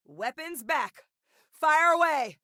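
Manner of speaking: high-pitched firing order; high battlefield command; combat call. Acted. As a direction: call out the all-clear to shoot.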